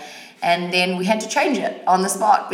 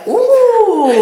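a high, loud, thrilled "Ooh"; delighted mock gasp; 12 dB louder than usual. Reacting to a magazine quote, high delighted squeal. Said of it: "and I was like".

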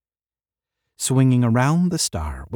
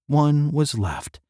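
plain reading voice

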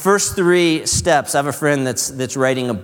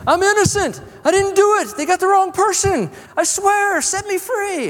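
very high, loud mock prisoner voices protesting innocence; squeaky whiny inmates begging to be let out; pleading prisoners; 4 dB louder than usual. Prisoners beg the king as he passes, high whiny pleading. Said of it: "the person's like".

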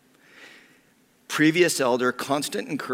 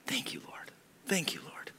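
whispered prayer; 9 semitones higher, 10 dB quieter than usual. Retold or imitated. Whispered thanks during remembered moment.

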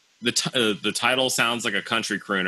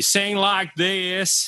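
high, slow, hammed-up Southern country twang; mock Southern drawl, pitched up; bad Southern accent; 7 semitones higher, much slower than usual. Shown as a demonstration. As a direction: draw it out in a fake Southern singer's accent. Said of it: "that was bad Southern accent".

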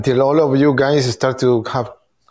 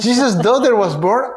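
higher, surprised question; 8 semitones higher than usual. Real, not acted. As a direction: ask it in disbelief. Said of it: "surprised question".

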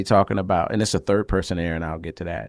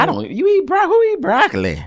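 very high, incredulous squawk; hammed-up disbelief, pitched way up; mock food shaming. Acting out someone judging broccoli eaters, mock-appalled.

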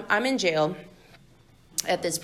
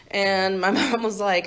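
ordinary delivery

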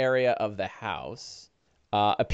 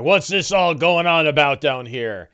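put-on orc voice, somewhat louder; gruff orc arriving; 2 semitones higher, 6 dB louder than usual. Another orc coming down the steps, loudly demanding answers. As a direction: demand an explanation as a bothered orc. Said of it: "you hear somebody coming down the steps".

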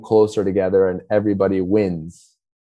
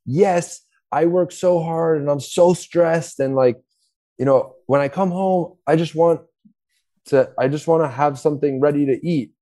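stressed partner; 7 semitones higher than usual. Retold or imitated. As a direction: pour it out like you're worn out. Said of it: "do something like".

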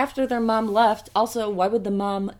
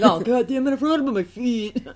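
annoyed wolf; 5 semitones higher, 3 dB louder than usual. Acted. Wolf annoyed at his mistake.

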